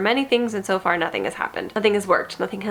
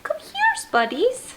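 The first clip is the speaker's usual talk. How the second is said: rising, very high calling of the cat. Real, not acted. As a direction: coax the kitty back in a squeak.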